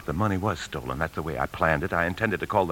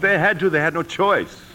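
sharp, high, louder protest; insistent outburst; 10 semitones higher, 3 dB louder than usual. Acted. Jim insists to the official they had no choice. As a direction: force it out, he's cornered.

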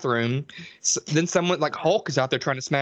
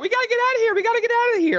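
squeaky high panicked repeat; shrill it out like a scared horror girl; panicked girls mimicked; 3 dB louder, faster than usual. Mocking the panicked girls in the basement, squeaky high. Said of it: "they're like".

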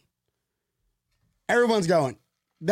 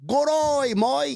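high, stretched fake British accent; 9 semitones higher, slower than usual. Shown as a demonstration. Host trying it on UK people, bad British accent. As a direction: do a hammed-up British accent, pitched up. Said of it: "let me try that British accent".

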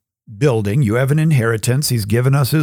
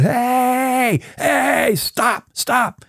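high panicked cries of stop; stone yells; 12 semitones higher, much slower than usual. Acted. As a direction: squeal for it to stop. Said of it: "the stone yells out".

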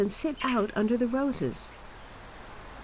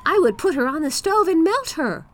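put-on high, loud boastful boy; bold little boy; 8 semitones higher, 4 dB louder than usual. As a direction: brag like a brave kid. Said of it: "cried the boy".

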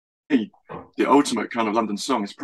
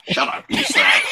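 mock insult; 3 dB louder than usual. Mock insult, shouted laughing.

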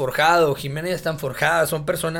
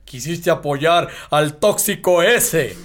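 mock sneering critic; 3 dB louder, slower than usual. Quoting critical public, slow.

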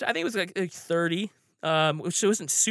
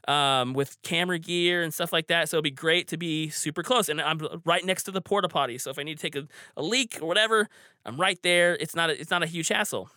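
usual speaking voice

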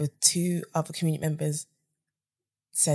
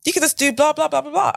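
eager suggestion; 10 semitones higher, 3 dB louder than usual. Retold or imitated. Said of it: "I was like".

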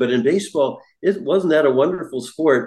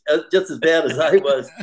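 ordinary talk

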